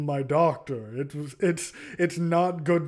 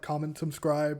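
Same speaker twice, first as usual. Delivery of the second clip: ordinary talk, nothing marked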